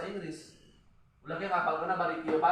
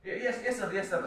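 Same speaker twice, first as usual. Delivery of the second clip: mock eager yes-man; 6 semitones higher than usual. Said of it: "misalkan ya".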